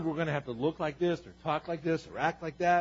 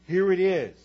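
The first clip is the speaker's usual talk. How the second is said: louder and louder declarations, each line punched; preaching at full force; 8 dB louder than usual. Acted. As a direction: build the list like a preacher rallying the church.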